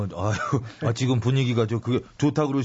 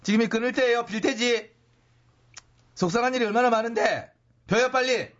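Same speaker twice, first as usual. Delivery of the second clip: high pushy voice; 10 semitones higher than usual. Acted. Pushy impersonation urging cigarette.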